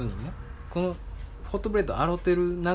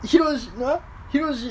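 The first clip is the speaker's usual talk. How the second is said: 父の真似; 12 semitones higher than usual. Retold or imitated. 父の台詞を高い声で.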